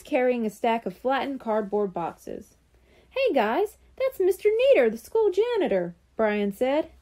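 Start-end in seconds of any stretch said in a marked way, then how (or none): 3.1-6.0 excited recognition. Acted. Brian spots the school janitor.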